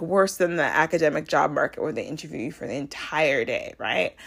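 ordinary talk

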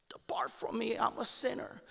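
a kneeling sinner whispering to the Lord; whispered confession. Acted. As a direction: confess it under your breath.

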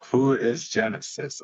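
usual speaking voice

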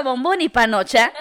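usual speaking voice

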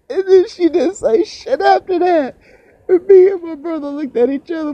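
high, breaking with laughter all through; laughing. Real, not acted. Recalling mom cursing by the fire, laughing helplessly. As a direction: laugh the words out, barely holding it together. Laughing while telling the punchline.

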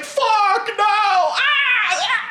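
high-pitched hammed-up scream of horror; mock horrified scream. She finds the house in shambles, mock screaming. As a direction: wail it way up high, imitating a horrified character.

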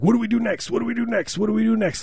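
high-pitched, repeated pretend eagerness; same high sing-song line over and over; eager mimicry. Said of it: "where he was like".

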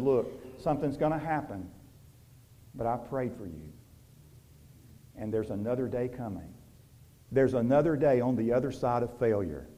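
soft, hushed voice of Jesus comforting; tender reassurance. Acted. Jesus reassures the one who failed, soft and tender. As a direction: comfort them quietly, like a promise.

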